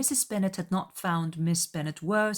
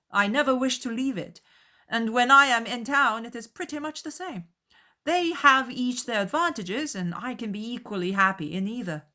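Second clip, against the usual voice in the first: higher, easy and pleasant; smooth things over kindly; amiable young host; 7 semitones higher, 5 dB louder than usual. A narrator's reading. Bingley placating the mother, higher and amiable.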